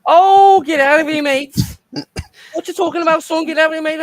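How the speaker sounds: high, loud Scouse outburst; shouted, high-pitched Scouse impression; Scouse accent. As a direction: protest loudly in broad Scouse. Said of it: "Can you do a scouse?".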